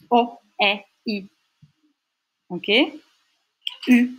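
"O, E, I" as very short separate sounds; short vowels. Shown as a demonstration. Teacher demonstrating Dutch short sounds, each one brief. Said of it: "il y a les sons courts".

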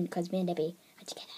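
rushed, whispered aside; quick whisper. Real, not acted. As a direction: whisper it fast, almost without voice. Hushed quick aside about relationship.

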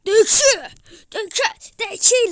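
loud, fast hype; excited shout. Real, not acted. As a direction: fire off the call fast. Oyunda heyecanlı anlatım. (heyecanlı, hızlı bağırış)

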